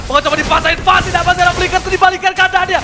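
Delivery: rising hype, loud and high; excited esports caster. Real, not acted. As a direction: call the fight with mounting energy. Hype caster call of a fight.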